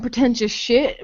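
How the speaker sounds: usual speaking voice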